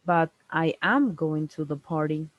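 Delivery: "am" lifted in pitch to correct; stress on AM. Shown as a demonstration. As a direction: hit "am" to contradict the listener. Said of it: "We want to correct the person".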